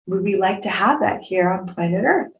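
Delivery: ordinary talk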